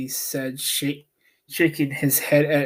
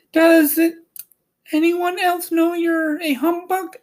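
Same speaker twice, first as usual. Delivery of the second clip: put-on high, girlish voice, louder; young Dorothy; 4 dB louder than usual. A narrator's reading. Dorothy asking Oz who else knows, high and girlish. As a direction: ask it like a startled little girl. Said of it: "asked Dorothy".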